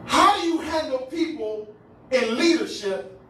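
high, emphatic sermon cadence; impassioned preaching. Real, not acted. Preacher challenging arrogant church leaders over hurting people, impassioned preaching. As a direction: challenge them in weighty, separated phrases.

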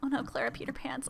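breathy unvoiced dismay; whisper. Real, not acted. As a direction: whisper it in secondhand embarrassment. Sim does something awkward.

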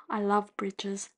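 ordinary talk

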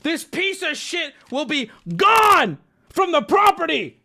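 high furious shout; screaming. Pretend. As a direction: scream it, losing control. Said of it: "parodying the angry note".

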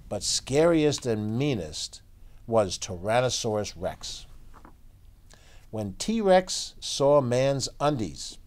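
put-on lively read-aloud for kids; story narration. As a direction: tell it like a bedtime picture book. Reads picture book aloud.

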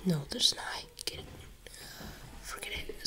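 frustrated hushed whisper; tense whispered grumble. Real, not acted. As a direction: whisper so she won't hear. Hiding from monster in game.

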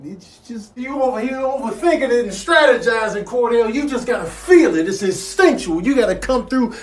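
high-pitched pep talk; urging coach. Acted. Friend telling Cordell to feel it, urging. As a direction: egg on a hesitant friend, high.

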